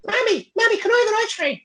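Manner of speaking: put-on pleading little Irish kid, squeaky high; very high Irish lilt, small child asking; high-pitched Irish accent. Shown as a demonstration. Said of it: "I had a really high-pitched accent".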